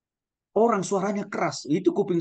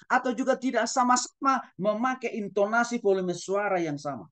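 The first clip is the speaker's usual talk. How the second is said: usual speaking voice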